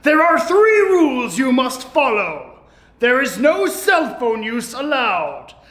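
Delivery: usual speaking voice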